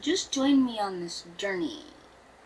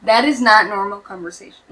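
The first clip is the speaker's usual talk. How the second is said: appalled; 7 dB louder than usual. Real, not acted. Appalled comment.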